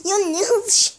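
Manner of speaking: loud, high startled cry; shocked outburst. Real, not acted. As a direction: blurt out your shock at the speed. Obstacle suddenly moves fast. (yüksek ve tiz şaşkın çığlık)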